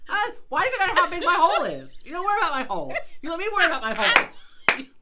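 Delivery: mock-defiant shout; defiant outburst. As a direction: stand your ground.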